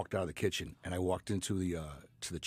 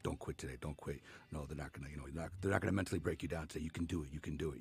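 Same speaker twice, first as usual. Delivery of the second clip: quiet self pep talk; 4 semitones lower, 7 dB quieter, faster than usual. Retold or imitated. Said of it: "kinda hype myself up".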